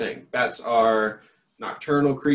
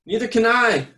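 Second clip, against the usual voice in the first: higher, louder howl; 12 semitones higher, 7 dB louder than usual. Acted. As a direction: howl it in alarm. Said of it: "howled Flitter".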